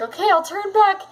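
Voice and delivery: high-pitched worried protest; mock panic. Gamer retelling being told to turn back, mock panicked. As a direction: retell fretting, pitch climbing well up. Retold panic at quest warning.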